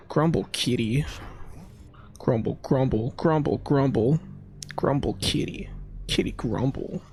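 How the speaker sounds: playful grumbling chant; kitty grumble. Pretend. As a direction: mutter it like a cranky cat. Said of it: "you could be an upset lion".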